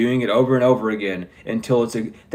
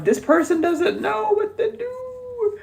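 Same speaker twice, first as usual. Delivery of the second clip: odd high voice; slower than usual. Real, not acted. Odd high drawn-out line.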